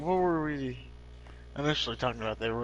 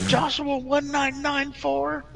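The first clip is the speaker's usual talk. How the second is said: YouTuber voice; 11 semitones higher, 5 dB louder, slower than usual. Pretend. YouTuber reading username.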